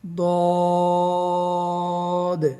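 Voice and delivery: দদ held long, half sung; chanted দদ; drawn-out dad. Shown as a demonstration. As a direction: stretch দদ four alif lengths. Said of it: "চার আলিফ টান দিয়ে পড়তে হবে".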